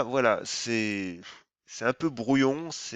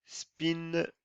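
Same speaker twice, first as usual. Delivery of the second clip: Spinguard said with an English accent, higher, English vowels; 5 semitones higher, 4 dB quieter than usual. Shown as a demonstration. Trader jokingly names the stock to viewers in English accent. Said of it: "avec mon accent anglais".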